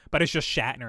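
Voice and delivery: normal talking voice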